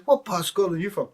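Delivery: high, loud, fast Glaswegian question; Glasgow accent. Retold or imitated. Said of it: "He said".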